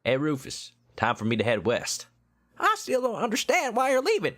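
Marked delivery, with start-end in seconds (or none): 2.6-4.4 high falsetto little brother; kid brother voice. Acted. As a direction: play the younger brother, pitched way up.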